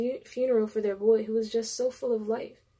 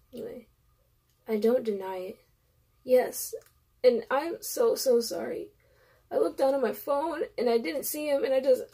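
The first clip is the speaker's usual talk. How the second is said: ordinary delivery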